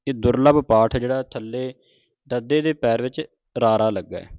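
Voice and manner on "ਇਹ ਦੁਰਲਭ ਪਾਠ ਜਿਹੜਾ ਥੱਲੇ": usual speaking voice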